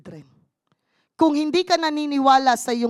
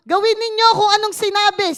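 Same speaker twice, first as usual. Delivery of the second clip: insistent mother; 9 semitones higher, 6 dB louder than usual. Retold or imitated. Mary's instruction, loud and high.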